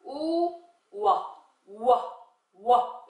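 "우, 와" as a rounded oo opening into wa; W sound demo. Shown as a demonstration. Teacher answering how W sounds, sounding it out slowly. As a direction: say "와" as separate, drawn-out W sounds. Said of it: "W 소리 어떻게 냈죠".

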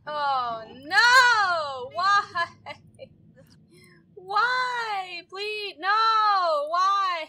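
high, loud wailing protest; anguished wail. Real, not acted. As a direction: cry out in despair. Despair as friend dies.

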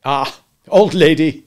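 hearty loud father. Acted. Peter fond greeting to Wendy.

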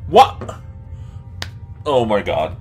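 ordinary talk

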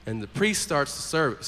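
ordinary delivery

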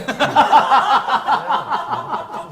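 long, high, loud laugh; loud burst of laughter. Real, not acted. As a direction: crack up loudly. Laughs at a silly request.

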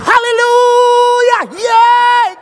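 high, drawn-out hallelujah scream; mock church frenzy at full volume; ecstatic congregant shout. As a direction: holler it long and high, imitating a worshipper. Acts out excited American congregant.

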